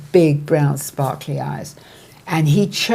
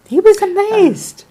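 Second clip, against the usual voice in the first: excited high pitch; 6 dB louder than usual. Real, not acted. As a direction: voice leaps high in wonder. Excited retelling.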